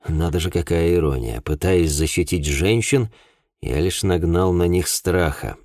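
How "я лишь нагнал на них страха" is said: plain reading voice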